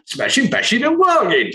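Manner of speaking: high, laughing Cockney orc accent; hammed-up Cockney orc accent, giggling. Shown as a demonstration. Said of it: "say it in a Cockney accent".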